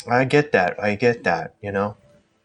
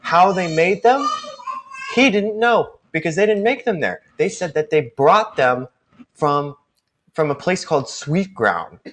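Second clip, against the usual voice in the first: lightly voiced chef, higher pitched; chatty chef character; 10 semitones higher than usual. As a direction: play a chef eagerly explaining, pitched up.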